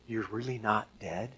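a hushed aside of shock; under his breath. Retold or imitated. Saul on the ground talking to Jesus, softly under his breath. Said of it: "kind of whisper under his breath".

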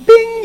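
a sudden very high, loud "Bing!"; playful sound effect of a radar ping. Acted. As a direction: pop out the noise like a radar blip.

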